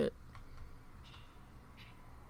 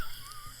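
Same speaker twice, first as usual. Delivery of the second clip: high giggle; 9 dB quieter than usual. Real, not acted. Laughing at sister's story.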